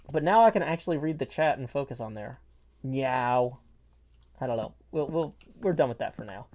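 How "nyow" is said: "Meow" as a "nyow"-like no, low; Roy Kent. Retold or imitated. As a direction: another go at the London footballer's no, "Meow". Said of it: "the way he says no".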